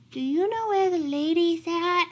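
high little dog voice, pleading question; little lost dog. A narrator's reading. Lost dog asking big dog about the lady, high-voiced. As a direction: ask in a small, high-pitched voice. Timid dog asks the yelling dog.

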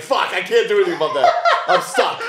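laughing frustration. Retold or imitated. Retelling stuck frustration.

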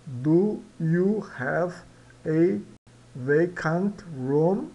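"Do you have a vacant room?" said very slowly, word by word; teacher slowing the question down; very slow repetition. Shown as a demonstration. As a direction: drag out each word of the question. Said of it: "do soa do".

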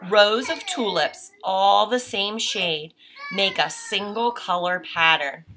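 clear, measured read-aloud voice; reading to children. Acted. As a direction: read the page to a child, unhurried. Reads picture book aloud.

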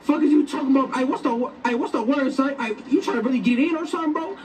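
ordinary talk

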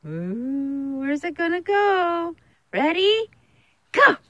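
high squeaky voice for the dog; squeaky praising a dog. Real, not acted. Teasing the dog before a throw.

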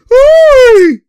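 loud, high, drawn-out whoop; excited whoop. Real, not acted. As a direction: cry out in delight at the play. Whoop after a play.

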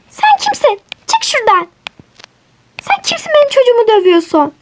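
high-pitched scolding mom; indignant mother demanding who they are; angry mother. Acted. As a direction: tell off the kids who hit your boy. Mother scolds kids hitting her son.

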